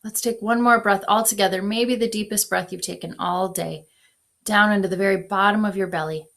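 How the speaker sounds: guided meditation. Acted. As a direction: ease them into breathing. Leads a breath prayer.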